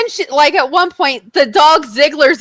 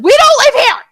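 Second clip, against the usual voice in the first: much higher, louder yell; retold yell; 11 semitones higher, 5 dB louder, faster than usual. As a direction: shout it in exasperation. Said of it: "I yelled at him".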